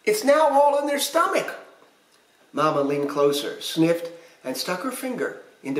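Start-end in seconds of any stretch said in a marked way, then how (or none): 0.0-1.5 put-on high-pitched little pirate baby; high storybook baby voice; piping baby voice. A narrator's reading. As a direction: pipe up with a child's complaint. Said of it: "Baby piped up".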